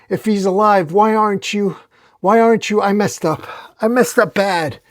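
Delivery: high, tearful acted pleading; starting to cry. A narrator's reading. Dylan breaking down in front of Venom, tearful. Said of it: "Dylan starts to cry".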